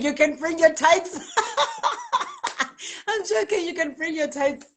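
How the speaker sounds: laughing through the words; laughing it off to viewers. Real, not acted. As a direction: giggle as you take it back. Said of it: "Guys, I'm joking".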